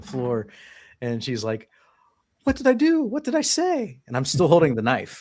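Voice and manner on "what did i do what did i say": very high, distressed mock voice; panicked wife imitation. Girlfriend on bathroom floor facing the knife, mock panic. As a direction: imitate a frightened, pleading woman.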